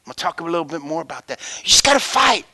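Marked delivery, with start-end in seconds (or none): 1.6-2.4 high, loud burst; preacher's loud call to fight; rising exhortation. Real, not acted. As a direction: punch it out to the crowd. Preacher pushes after hush.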